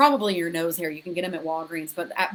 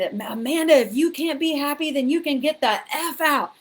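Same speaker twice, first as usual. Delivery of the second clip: higher and louder, sharp retold anger; defensive; 7 semitones higher, 4 dB louder than usual. Retelling yelling at Amanda to leave, defensive and heated. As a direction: snap at her as you recall it. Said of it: "I got defensive".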